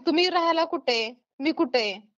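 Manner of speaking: normal talking voice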